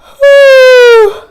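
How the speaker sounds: high, loud sighing yawn. Shown as a demonstration. Teacher demos a yawn to find head voice. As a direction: yawn out loud with a rising sigh. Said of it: "can you try yawning right now? Like".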